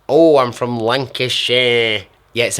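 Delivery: a hammed-up northern English Lancashire voice; overdone Lancashire accent, half laughing at himself. Shown as a demonstration. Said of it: "I actually annoyed myself with that noise".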